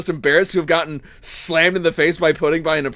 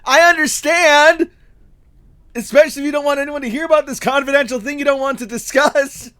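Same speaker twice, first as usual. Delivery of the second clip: high and loud, announcing the secret; loud mock secrecy; 8 semitones higher, 3 dB louder than usual. Apprentice teasing Joanne by loudly repeating her secret. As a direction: broadcast it so everyone hears.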